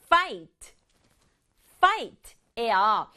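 ordinary talk

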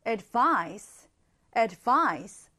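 advice with the weight on -vice, ad- light; ad-VICE, clearly stressed at the end; stress on the second syllable. Shown as a demonstration. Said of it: "뒤에 강세를 강하게 넣어주고 앞은 약하게".

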